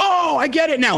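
mock the viewer's sudden lightbulb; realization.

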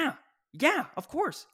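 high-pitched imitation of agreeing kids; a hint of relieved teen; lightly voiced kid. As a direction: agree eagerly, pitched well up. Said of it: "kids are like".

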